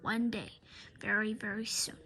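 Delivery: low, soft menacing whisper. Acted. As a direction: whisper the threat like a villain. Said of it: "Mister Krupp vowed".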